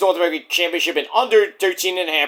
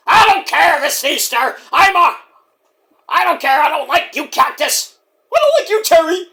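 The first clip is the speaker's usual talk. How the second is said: higher, louder angry back-and-forth; acted quarrel; 10 semitones higher, 4 dB louder than usual. Terry and Cactus argue on Easter, higher and louder. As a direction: snap at the other in character.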